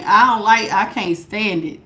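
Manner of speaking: ordinary talk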